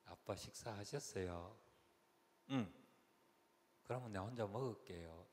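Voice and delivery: demure elder daughter. Retold or imitated. First daughter politely asking dad about his meal, demure. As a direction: imitate a reserved daughter, unhurried and soft. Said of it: "이런 목소리입니다".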